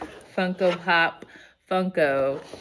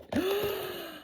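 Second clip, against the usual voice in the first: gasp; 6 dB quieter than usual. Real, not acted. Gasp at gift.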